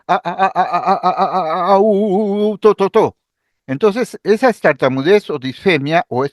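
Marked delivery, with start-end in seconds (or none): 0.1-3.2 stuttering demo. Shown as a demonstration. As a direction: stammer the syllables, repeating a, then u, then to. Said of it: "empieza a decir".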